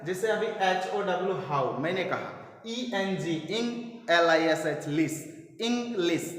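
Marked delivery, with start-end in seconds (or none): 2.7-6.3 "इंग्लिश" split into two separate beats; teacher spelling out "इंग्लिश" in syllables. Shown as a demonstration. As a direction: spell each chunk, then sound it out as "इंग", "लिस". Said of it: "ऐसे करके जब हम लोग syllable करके".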